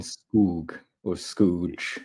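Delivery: Skoog or Scooge, drawn out slowly; careful pronunciation. Shown as a demonstration. Showing co-hosts two options, slow careful Skoog or Scooge. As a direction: stretch the vowel in Skoog, then offer Scooge. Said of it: "hear someone pronounce it".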